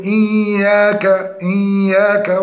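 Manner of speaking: إياك with the double y hummed through the nose; nasalised ya. Shown as a demonstration. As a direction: stretch the y of إياك nasally, as a mistake. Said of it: "خروج الياء من الخيشوم مصحوبة بغنة". (إياك بغنة وتمطيط في الياء)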